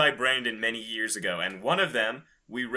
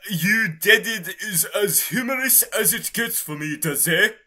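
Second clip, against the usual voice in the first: higher, louder, hammed-up read; mock character voice; 9 semitones higher, 6 dB louder than usual. Marisa rebutting Reimu, a lightly voiced hammed-up read. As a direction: play Marisa with a goofy lilt. Co-host voicing Marisa in fic.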